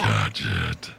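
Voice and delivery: very low villain growl; Doctor Claw voice. Retold or imitated. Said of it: "same voice for Doctor Claw".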